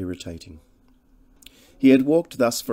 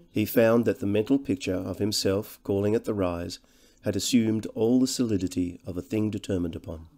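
ordinary delivery

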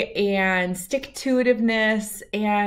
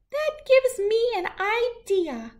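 high, thoughtful little voice; a tiny shrew hatching a plan. Acted. Shrew gets an idea, tiny high voice.

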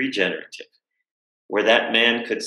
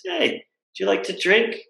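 put-on elderly gentleman; 13 semitones higher than usual. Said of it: "He says".

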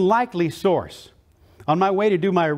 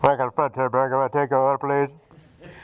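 lower, bored voice over the speaker; drive-through order taker; 5 semitones lower than usual. Retold or imitated. Burger worker asks for the order, low drive-through voice. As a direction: drone it like a fast-food intercom worker. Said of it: "the guy says".

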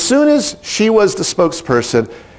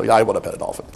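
low, quiet dopey guy voice; lightly voiced bored guy; mock reluctant guy; 7 semitones lower, 6 dB quieter, much faster than usual. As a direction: play a half-hearted man in the crowd. Reluctant guys volunteering.

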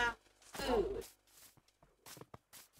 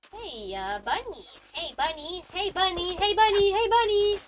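calling to the bunny, getting louder each time; calling out, building; 4 semitones higher, 5 dB louder than usual. Real, not acted. Calling a bunny in the game, building to a shout. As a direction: call the bunny, rising from normal to a loud, high shout.

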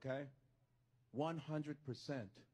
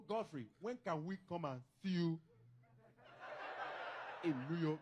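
imitated thick Nigerian accent. Cousins phoning to ask about visiting, Nigerian accent. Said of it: "about that fucking accent".

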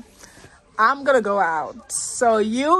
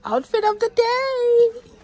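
announcer intro; 12 semitones higher than usual. Acted. Announcing her vlog segment.

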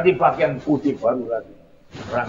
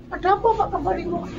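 high falsetto, softer, asking why he came home; high questioning voice; 4 dB quieter, much faster than usual. Acted. A bystander asks the young man why he is back, in a high voice. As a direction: squeak it up high as a curious bystander.

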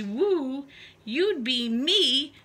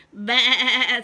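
bleating sheep voice; 8 dB louder than usual. Acted. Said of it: "said the sheep".

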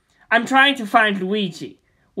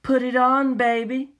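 female voice; 5 semitones higher than usual. Acted. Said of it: "Female voice".

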